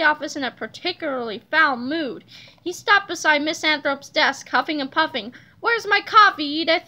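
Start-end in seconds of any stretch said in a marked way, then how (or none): 5.6-6.8 slightly raised, demanding boss voice; mild shout. A narrator's reading. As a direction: call out crossly for it, not full volume. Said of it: "he shouted".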